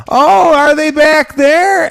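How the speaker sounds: high, slow startled "oh, are they back there"; startled passenger. Retold or imitated. Passenger in front whips around, high and startled. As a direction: jerk around in mock surprise. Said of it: "someone whips their head around".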